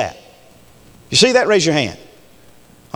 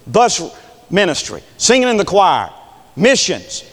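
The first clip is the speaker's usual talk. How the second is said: ordinary talk, nothing marked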